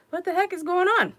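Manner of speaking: high, loud alarmed cry; wife alarmed at a honking truck, high cry; startled outcry. Retold or imitated. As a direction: yelp it out in alarm. Alarmed retold reaction.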